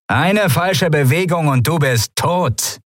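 high, slow warning cry; threatening command. A narrator's reading. Wache bedroht die ertappte Eindringende. (hohe, gedehnte Drohung)

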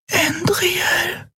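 faint, breathy whisper; breathed whisper. Acted. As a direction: barely breathe the name. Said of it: "hauchte sie". (gehauchtes Flüstern)

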